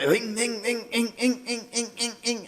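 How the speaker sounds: high, fading imitation of a starter motor that won't catch; engine cranking. Van won't start at night, engine noise imitation. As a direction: mimic a dying engine turning over.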